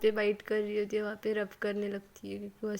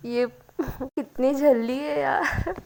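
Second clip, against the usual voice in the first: slow words broken by laughter; laughing; 5 semitones higher, 7 dB louder, slower than usual. A narrator's reading. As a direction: laugh through it, amused at her drunk friend. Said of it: "एकदम हंसने लगती है".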